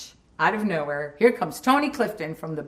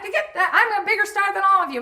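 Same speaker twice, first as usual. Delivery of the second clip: mock scream; 14 semitones higher than usual. Tony Clifton screaming he is the bigger star. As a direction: rant like a spoiled celebrity. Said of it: "he starts screaming".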